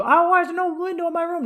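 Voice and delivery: high, whiny complaining tenant. Retold or imitated. Tenant whining to the landlord, high and put-out.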